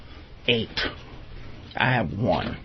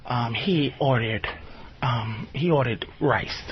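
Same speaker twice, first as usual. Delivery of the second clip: imitating girlfriend; 6 semitones lower, 4 dB quieter than usual. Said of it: "opens her mouth to go".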